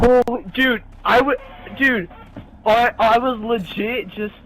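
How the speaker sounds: loud, breathless disbelief; shaken and loud. Real, not acted. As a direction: still yelling, rattled. Still reeling after dying.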